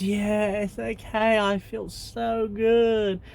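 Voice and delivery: put-on high, slow, swooning girl; enchanted girl melting with bliss; spellbound girl. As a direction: stretch it out, high and entranced. Girl under love spell agrees.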